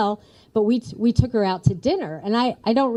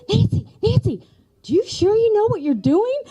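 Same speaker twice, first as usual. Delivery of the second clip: put-on higher, fussing elderly aunt calling after her niece; worried old aunt; 11 semitones higher than usual. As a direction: fret over her like an anxious aunt. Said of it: "And she goes".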